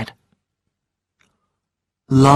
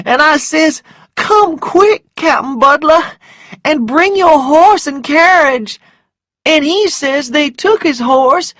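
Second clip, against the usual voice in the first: put-on high, loud, frantic servant girl; excitable high-pitched wail of alarm; panicked servant girl; 11 semitones higher, 5 dB louder than usual. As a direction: cry out the alarming news in a high voice. Prissy excitedly reports her errand.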